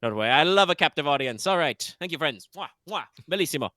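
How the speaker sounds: loud, high, grand showman laughing; hammy Italian Duke. Acted. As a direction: play to the crowd with flair. Duke performs to the crowd.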